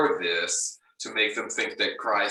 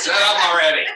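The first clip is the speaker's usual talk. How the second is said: loud laughing yell, much higher; shouting; 9 semitones higher, 11 dB louder than usual. Retold or imitated. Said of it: "stand up and say".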